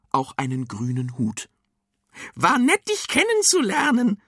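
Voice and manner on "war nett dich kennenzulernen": high, loud witchy farewell; calling out. A narrator's reading. Cucumber witch saying goodbye as she vanishes, calling out. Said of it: "rief die Gurkenhexe".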